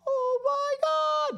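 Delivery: very high, drawn-out mock squeal; over-the-top falsetto gush; gushing dog lover. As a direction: squeal it loud and slow, overdoing it. Acts gushing human meeting dog.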